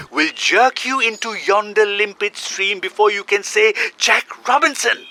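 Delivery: high, urgent snake voice; python voice. A narrator's reading. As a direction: warn him like a fussy snake. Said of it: "This is the way the bicolored python rock snake always talks".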